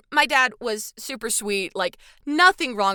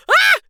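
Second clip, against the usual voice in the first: sharp yelp, much higher and louder; mock startled scream; 12 dB louder than usual. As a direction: jump out of your skin, yelp. Startled by husband's growl.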